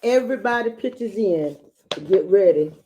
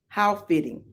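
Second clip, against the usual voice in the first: ordinary delivery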